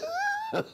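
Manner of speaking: sudden high burst of laughter; a real laugh breaking out. Real, not acted. Amused that Gregory forgot the step, a quick high laugh.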